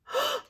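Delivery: high sharp gasp of surprise; shocked gasp. Acted. Shocked at naked child.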